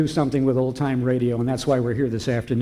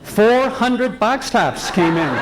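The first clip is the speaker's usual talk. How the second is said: slow, loud and high with a laugh in it; laughing proud announcement; triumphant reveal; 7 semitones higher, 5 dB louder, slower than usual. Real, not acted. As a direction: land the big news with delight. Triumphant payoff of his story.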